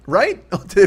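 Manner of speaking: normal talking voice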